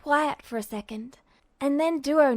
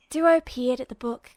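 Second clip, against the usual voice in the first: plain reading voice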